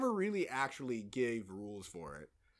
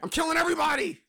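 high, loud victory yell; gloating shout; 14 semitones higher, 8 dB louder than usual. Real, not acted. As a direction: crow over everyone you beat. Gloating shout after winning.

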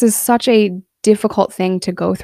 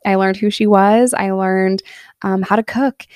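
normal talking voice

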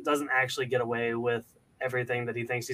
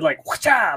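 whoosh sound effect; 10 semitones higher, 5 dB louder than usual. Retold or imitated. Imitating a thrown toy.

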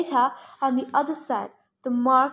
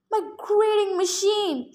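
higher-pitched outcry of dismay; dismayed cry; 5 semitones higher than usual. A narrator's reading. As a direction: cry out over a ruined treasure. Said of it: "she cried".

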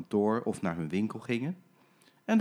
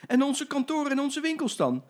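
much higher, louder protest; indignant gentlemen; 4 dB louder than usual. Acted. Gentlemen protesting to the driver, indignant. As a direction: complain about your offices. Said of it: "riepen alle meneren".

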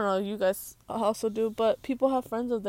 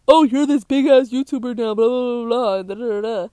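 louder, mocking the classmate's gushing; mocking impression of a flatterer; mock fan voice; 2 semitones higher, 9 dB louder than usual. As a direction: ham up a clout chaser sucking up. Mocking old classmate's flattering words.

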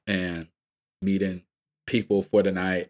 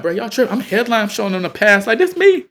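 much higher and louder laughing plea; frantic pleading; 13 dB louder than usual. Retold or imitated. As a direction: beg them, almost shouting through laughter. Pleading headliner status to police.

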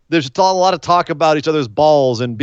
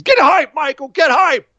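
high, hyped-up taunt; mock hype-man yelling at the commentator; Mojo Rawley; 10 semitones higher than usual. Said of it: "We're back to hype Mojo".

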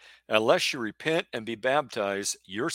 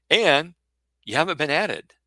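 sudden loud; 4 semitones higher, 6 dB louder than usual. Real, not acted. Preacher stressing a point.

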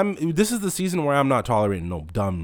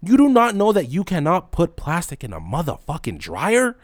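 ordinary delivery

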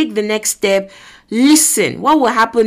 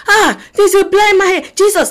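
high, loud crowd alerting someone; excited calling out; 9 semitones higher, 5 dB louder than usual. Acted. Crowd waves Jesus down for a blind man, excited call. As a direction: call out urgently, pitched way up.

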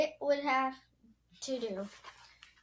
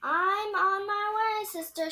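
shouting; 8 semitones higher, 6 dB louder than usual. A narrator's reading. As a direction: call back to someone in another room. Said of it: "shouted Baby".

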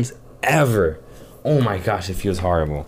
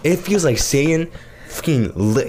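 ordinary talk

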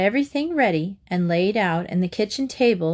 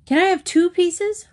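put-on eager boy; 10 semitones higher, 4 dB louder than usual. A narrator's reading. Eager boy asks for cake.

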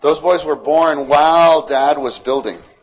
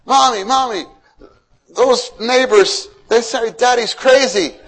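put-on much higher, slow child's complaint; upset child; 10 semitones higher, 5 dB louder than usual. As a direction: tattle to mom like a kid.

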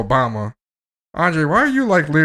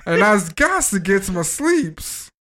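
mock pleading servant; 8 semitones higher, slower than usual. Teasing his friend, begging the boss in a high hammed-up voice.

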